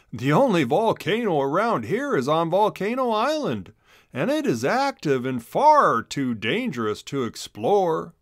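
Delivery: a hint of a kind teacher explaining. A narrator's reading. Teacher voiced in story.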